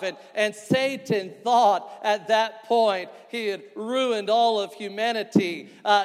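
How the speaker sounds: loud, high pulpit proclamation; full-force preaching. Real, not acted. Preacher tells congregation Satan broke God's heart, full-force preaching. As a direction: drive each phrase home, stretching the key words.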